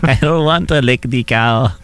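hammed-up childish refusal; whiny child. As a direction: play a reluctant kid, dragging it out. Child role in the bit.

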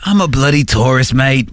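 mock British-turned-Australian accent; jokey Aussie-tinged tourist voice; Australian-sounding accent. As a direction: put on a loose Australian accent. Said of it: "like, Australian accents".

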